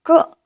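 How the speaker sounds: क said with the nukta sound. Shown as a demonstration. Teacher showing how a dotted letter is read, क with nukta. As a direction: sound out क alone as a borrowed nukta consonant. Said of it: "इस तरीके से पढ़ते हैं".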